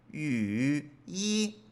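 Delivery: two separate "Y, y", each dipping and rising; laboured full third tone on each "y"; forced full tones. Shown as a demonstration. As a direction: drag each "y" down then up, pause between. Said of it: "se escucha como que forzado".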